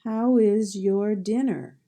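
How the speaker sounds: "How is your dinner?" said slowly and clearly, stress on How; slow dictation read; slow model sentence. Shown as a demonstration. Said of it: "see if you can figure out the sound".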